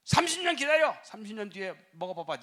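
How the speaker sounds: high loud stress on 년; shouted rebuke. Retold or imitated. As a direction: yell 삼십 년 at him. 다시 호통치며 반복. (신랑에게 "삼십 년"을 높고 크게 외치는 호통)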